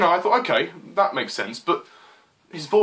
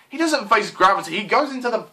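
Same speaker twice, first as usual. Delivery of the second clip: exasperated, pitch climbing to the curse; frustrated rant; 5 semitones higher than usual. Real, not acted. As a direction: rant higher and higher until it bursts. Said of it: "let out all my frustration".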